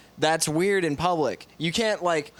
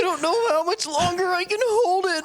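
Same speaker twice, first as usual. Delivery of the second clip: very high, strained whine; hammed-up desperate wail; mock crying. Joining in as the penis that must pee, mock crying. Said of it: "he starts, like, crying".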